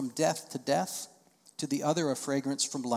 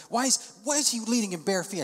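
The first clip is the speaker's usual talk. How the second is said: nosy church member, scandalized and shrill; mock indignant churchgoer; 7 semitones higher than usual. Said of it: "People kind of".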